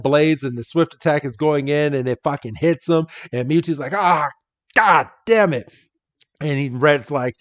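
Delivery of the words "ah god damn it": higher-pitched acted curse; mock exasperation. Mewtwo reacts to being hit, mock exasperated. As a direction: curse like a cartoon villain taking a hit.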